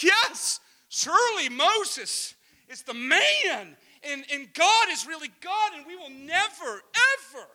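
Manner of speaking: high, drawn-out exuberant vow; hammed-up zealous declaration; too loud, passionate. Israel after seeing God's power, acted loud exuberance. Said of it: "am I too loud".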